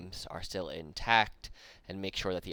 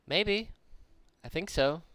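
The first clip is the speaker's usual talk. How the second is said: a much higher, louder hobgoblin voice; other hobgoblin; 9 semitones higher, 5 dB louder, slower than usual. Acted. A second hobgoblin looks up and answers, higher and louder. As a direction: pop up brightly with a guess. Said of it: "one of them kinda looks up and goes".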